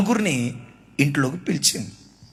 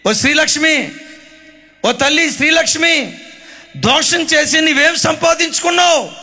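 calling out to her; 7 semitones higher than usual. Acted. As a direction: call out to the woman, accusing. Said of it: "నేనంట".

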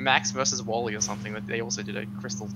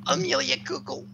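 very high-pitched caricature; impression voice; 13 semitones higher than usual. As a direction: push the name up into a high mimicking voice. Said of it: "not the time or place for that impression".

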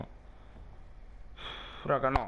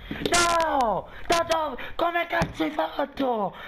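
furious shout; 12 semitones higher, slower than usual. Real, not acted. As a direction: scream in rage at the screen. Killed in game, raging.

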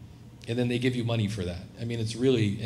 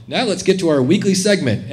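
louder, blaring segment intro; sudden loud volume; 4 semitones higher, 9 dB louder than usual. Retold or imitated. Podcast host jumping back to full volume for his weekly segment. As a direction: blast it out so the windows shatter. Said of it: "comes on at, like, regular volume".

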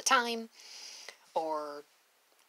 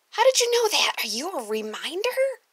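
put-on high, loud amazed kid; amazed child; 10 semitones higher, 9 dB louder than usual. Child amazed at teacher's guess, high and loud. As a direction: gasp it out like a stunned child.

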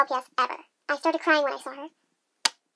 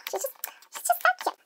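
squeaky cutesy gush; 14 semitones higher, 4 dB quieter than usual. Real, not acted. Collector squealing over her cute toy, high and gushy. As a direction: squeal it like a gushing fan.

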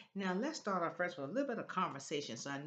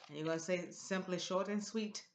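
usual speaking voice

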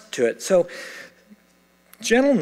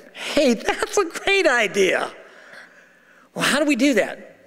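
hammed-up eager agreement; mock eager; 8 semitones higher than usual. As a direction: play a keen couple jumping at the idea. Acted eager couple takes up marriage.

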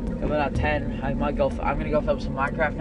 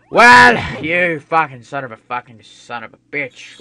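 angry cursing; 14 dB louder, slower than usual. Real, not acted. Cursing at the game.

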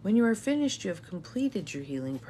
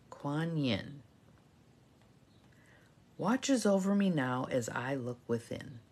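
reverent prayer. Acted. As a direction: intone each line for repeating. Leads a call-and-response prayer.

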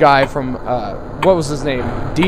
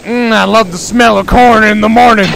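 character voice; 5 semitones higher, 6 dB louder than usual. Retold or imitated. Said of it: "He's like".

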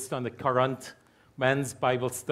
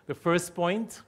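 usual speaking voice

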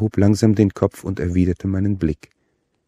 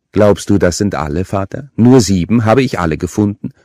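taunting; 3 semitones higher, 7 dB louder than usual. A narrator's reading. Killer taunting the priest about the bodies. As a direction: needle him with each question.

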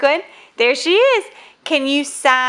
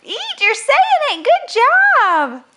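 delighted praise; 12 semitones higher, 4 dB louder than usual. Real, not acted. Parent thrilled Elise is saying it, high delighted praise.

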